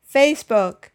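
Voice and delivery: FACEbook with FACE hit hard; teacher's model of Facebook, weight on the first syllable; first-syllable stress. Shown as a demonstration. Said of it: "putting the stress on the first syllable".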